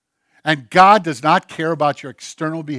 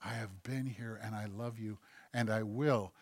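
much softer, lower reassuring voice; soft whisper; 6 semitones lower, 17 dB quieter than usual. Acted. God answering the praying believer, barely above a whisper. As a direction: breathe it gently, almost silent. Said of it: "And God whispers to you".

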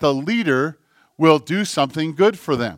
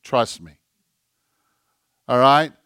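gentle smiling God; 4 semitones lower than usual. Acted. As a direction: say it tenderly, as if smiling down at them. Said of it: "God smiles and says".

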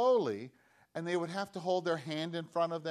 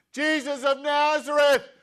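loud, high call across a crowd; shouting; 11 semitones higher, 12 dB louder than usual. Acted. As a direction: cry out for his attention. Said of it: "he started shouting".

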